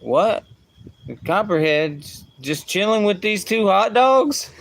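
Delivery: hammed-up little boy in amazed disbelief; imitating his young son. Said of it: "he goes".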